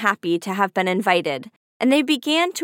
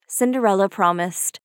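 usual speaking voice

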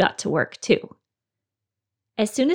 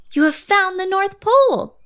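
high, loud triumphant declaration; excited announcement; 11 semitones higher, 6 dB louder than usual. A narrator's reading. Christopher Robin telling Pooh he found the Pole, triumphant. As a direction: announce it like a big discovery.

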